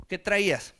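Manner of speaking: cocky Mexican street talk, pushing to pick a fight; a swaggering Mexican tough calling Paul out; Mexican street slang. Acted. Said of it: "En lenguaje mexicano se le apareció".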